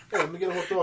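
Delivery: high, laughing fake Boston order; hammed-up Boston voice ordering food; mock Boston accent. Friend asked for a Boston accent, laughing Boston voice. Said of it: "Is that a Boston accent?".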